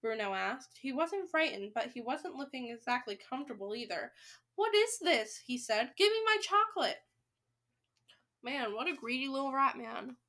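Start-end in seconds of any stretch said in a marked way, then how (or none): 4.5-5.4 high, indignant question; impatient kid, pitched up; demanding boy. A narrator's reading. Impatient boy demands his chocolate. 6.0-7.0 high, insistent demand; pitched-up bossy boy. A narrator's reading. Impatient boy demands his chocolate.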